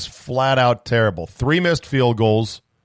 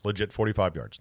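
"Legit" dropped softer, much quieter; quiet proud emphasis; 2 semitones lower, 7 dB quieter than usual. Shown as a demonstration. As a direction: let it land softly, almost to yourself. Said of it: "That's my record".

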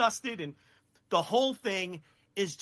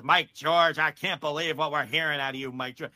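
lower, put-on announcer's disbelief; lightly voiced wrestling interviewer, pitched down; imitated interviewer; 6 semitones lower than usual. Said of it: "he's telling Gordon Solie".

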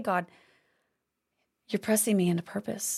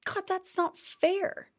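whiny complaint; 9 semitones higher, slower than usual. Retold or imitated. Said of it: "how many of us have complained?".

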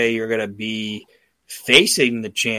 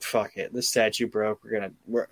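mock shrug; 2 semitones lower, 7 dB quieter than usual. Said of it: "Owen in that moment was like".

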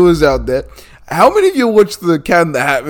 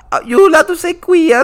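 high copy of the mum voice; lightly mock the mum's voice; man doing a woman's voice; 13 semitones higher, 4 dB louder than usual. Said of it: "does not sound like my mum".

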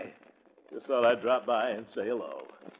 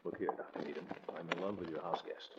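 quiet rehearsal; 8 semitones lower, 13 dB quieter than usual. Pretend. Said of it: "here goes".

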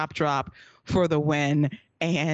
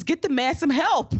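imitating Booker; 9 semitones higher than usual. Host quoting Booker calling for help, higher mock voice. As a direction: play Booker urging help for the ref. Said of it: "And Booker says".

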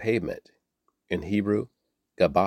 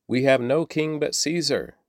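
higher, mild narrated shout; shouting back; 6 semitones higher than usual. A narrator's reading. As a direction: fire back a restrained shout. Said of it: "the leading priest shouted back".

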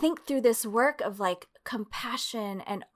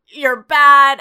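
much louder accusing snap; mock condemnation; 5 semitones higher, 15 dB louder than usual. Society condemning young women, loud accusing. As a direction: bark it like a judgment.

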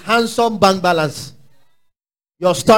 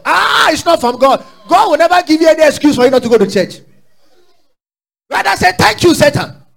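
loud, high preacher's outburst; raised, scolding pulpit voice; indignant rebuke; 9 semitones higher, 6 dB louder than usual. Real, not acted. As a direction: cry out in protest at the excuse. Preacher rebukes the imagined excuse.

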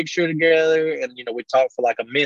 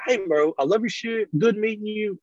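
higher fan farewell; a hint of a happy fan, pitched up; imitating a fan; 7 semitones higher than usual. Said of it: "And then like".

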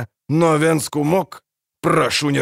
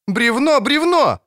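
high, loud warning cry; alarmed shout; 13 semitones higher, 4 dB louder, slower than usual. Acted. Ciri shouts at the dwarf to warn of the log.

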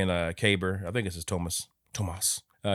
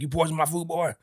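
mock accusing shout; 9 semitones higher, 4 dB louder than usual. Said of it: "he's like".

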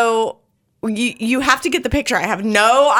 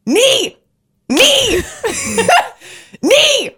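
high, loud barked command; yelling a command; 14 semitones higher, 6 dB louder, much slower than usual. Retold or imitated. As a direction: shout it at the dog like a drill sergeant. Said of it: "I start yelling".

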